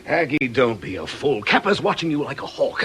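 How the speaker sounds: usual speaking voice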